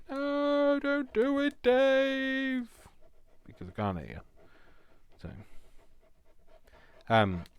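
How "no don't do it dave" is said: high, loud, squeaky mock warning; shrill cartoon voice telling herself off; mock scolding voice. Imagined bossy voice warning Dave off in a high squeak. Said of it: "nobody's gonna be able to say".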